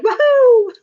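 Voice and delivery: very high game-character whoop; Mario. Retold or imitated. Imitating Mario jumping into portals, high whoop. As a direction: let out a high, gleeful jumping cry. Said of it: "you'd be like".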